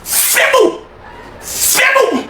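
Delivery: high, drawn-out goal cry; excited cry. Real, not acted. Congolese fan celebrating the goal, high joyful cry. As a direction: cry the word out in joy.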